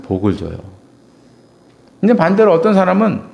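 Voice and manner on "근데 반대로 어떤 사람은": normal talking voice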